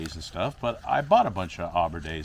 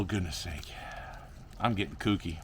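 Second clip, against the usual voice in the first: ordinary talk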